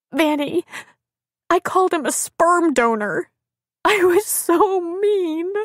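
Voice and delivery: very high tearful wail; sobbing. A narrator's reading. Crying woman confesses insult to friend, sobbing. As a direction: wail it with acted remorse. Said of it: "her words caught in a sob".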